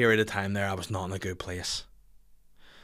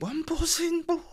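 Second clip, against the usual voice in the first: much higher, put-on dopey voice; mock stoner. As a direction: play the drugged-up guy saying he's buzzing. Mocking someone high on drugs.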